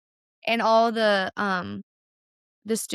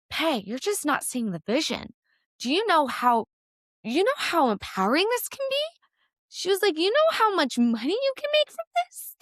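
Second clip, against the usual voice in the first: teammate's excited pitch; 8 semitones higher than usual. Retold or imitated. Teammate hyping her up about the money, excited and rising high. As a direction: pitch climbing higher and higher with excitement. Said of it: "She goes".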